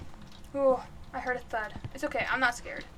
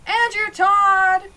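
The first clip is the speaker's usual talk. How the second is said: slow, drawn-out yell, louder and higher; yelling a name; 5 semitones higher, 9 dB louder, much slower than usual. Real, not acted. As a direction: call the ghost by name at full volume. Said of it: "by yelling the guy's name out".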